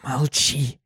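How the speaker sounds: urgent hushed warning; quick whisper. Acted. Kuna secretly warns the unicorn, whispered. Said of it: "быстро прошептала".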